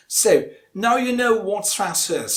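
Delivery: ordinary delivery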